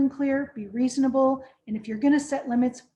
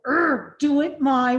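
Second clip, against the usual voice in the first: louder, slow growl of frustration; frustrated power struggle; 3 semitones higher, 7 dB louder, slower than usual. Shown as a demonstration. Trainer acting out a power struggle, a slow frustrated growl. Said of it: "power struggle feels like".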